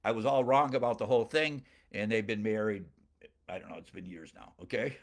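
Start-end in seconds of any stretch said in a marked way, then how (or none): none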